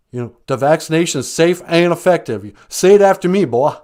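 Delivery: loud, pushy demands; bullying caller. Acted. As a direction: lean on him loudly like a bully. Caller bullies host.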